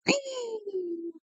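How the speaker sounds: high-pitched giggle; a quick squeaky laugh. Real, not acted.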